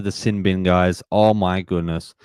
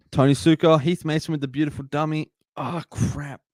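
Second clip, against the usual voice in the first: excited play call; 8 semitones higher than usual. Real, not acted. Live break, cheering the play.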